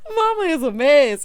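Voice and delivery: loud high laughter near tears; shrieking laugh bursting out; laughing hard. Real, not acted. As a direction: crack up till it hurts. Laughing at mom's joke.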